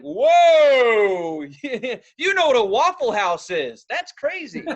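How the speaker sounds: mock amazed.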